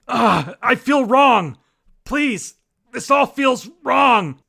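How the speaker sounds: slow, high, loud anguished cry; desperate shout. Acted. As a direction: scream it out in drawn-out horror. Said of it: "Sorry, I had to perform that one".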